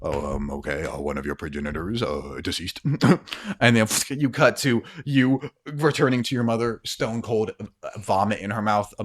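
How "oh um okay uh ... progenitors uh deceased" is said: lower, flat alien observer voice; detached alien, listing a death like data; alien entity voice. Acted. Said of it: "and he goes".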